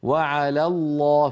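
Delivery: slow وعلى الله with a light على and a heavy الله; correct lam contrast. Shown as a demonstration. Teacher repeating the correct form for students. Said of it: "فيرقق لام على ويفخم لام لفظ الجلالة".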